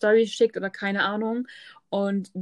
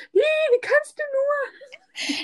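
mock outrage; much slower than usual.

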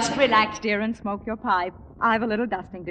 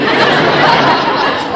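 delighted laugh; 10 dB louder than usual. Acted. Wife greets surprise guests.

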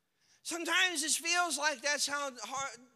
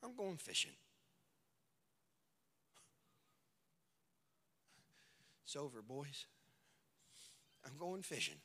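defeated Peter; 9 semitones lower, 11 dB quieter than usual. Acted. Defeated Peter after the tomb.

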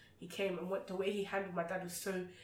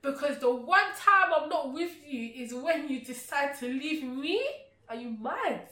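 angry at dad; 6 semitones higher, 8 dB louder than usual. Retold or imitated. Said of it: "I was peed off".

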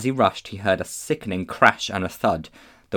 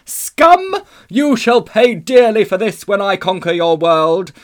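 loud, high, exultant proclamation; clear ringing witch voice; 10 semitones higher, 11 dB louder, slower than usual. A narrator's reading. Witch threatening to conquer the world, ringing triumphant shout. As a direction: ring out like a great bell. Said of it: "came the voice of the witch, clear like a great bell".

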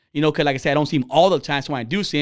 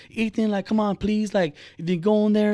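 higher, gently pleading imitation of a mom; bargaining mom; 5 semitones higher than usual. Mom pleading with son to join class, bargaining. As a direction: coax the kid, lightly voiced. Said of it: "almost like she's bargaining with this guy".

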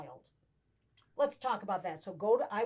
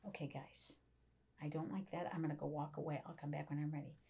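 much softer, near whisper, calm and controlled; low, quiet voice; 8 semitones lower, 14 dB quieter than usual. Retold or imitated. Mother calming her arguing kids, hushed and low. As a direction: drop to a hushed, steady hush to defuse it. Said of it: "I turned my voice really low".

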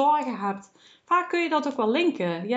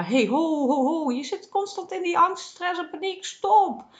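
mock alarm call; 6 semitones higher than usual. The body warns a stressed listener: rising half-mock alarm shout. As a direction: call out a warning like your body shouting at you. Body voiced calling out warning.

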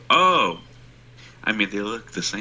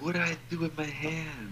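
high, soft mock-flustered voice; mock helpless panic; 8 semitones higher, 7 dB quieter than usual. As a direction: imitate someone helplessly flustered, small and high. Mocking the panicking mom's helpless question.

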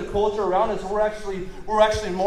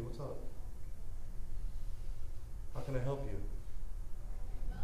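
meet their anger with a hushed, caring voice; answering an angry person softly and lovingly; 6 semitones lower, 12 dB quieter than usual. Shown as a demonstration. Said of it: "a soft answer turns away wrath".

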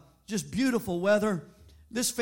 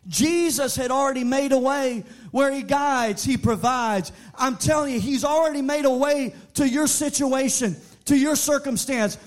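loud, rising preacher's cry to the congregation; preaching; 6 semitones higher, 6 dB louder than usual. Acted. Preacher urging the congregation, loud and rising. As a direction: build the fire of the sermon.

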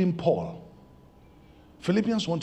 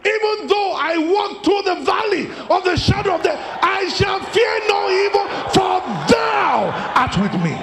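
preaching at full force; 6 dB louder than usual. Acted. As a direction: shout the psalm like a battle cry. Shouted psalm at climax.

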